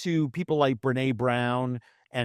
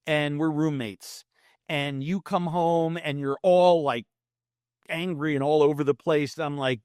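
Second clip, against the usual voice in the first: usual speaking voice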